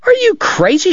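very high, indignant woman; outraged cry. A narrator's reading. Kate to Doc after he shoved her down, crying out. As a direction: cry it out, voice leaping up. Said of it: "she cried".

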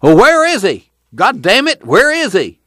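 put-on high, cantankerous old grandpa; irate old man's high holler; demanding. A narrator's reading. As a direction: demand it sharply, pitch shooting up. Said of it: "Grandpa demanded".

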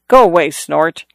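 ordinary delivery

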